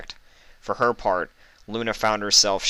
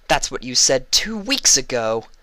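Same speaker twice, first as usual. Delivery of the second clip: sharp, loud, higher retort; snapping; 6 semitones higher, 5 dB louder than usual. A narrator's reading. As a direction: snap back at her. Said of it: "Luna snapped".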